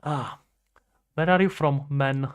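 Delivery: ordinary delivery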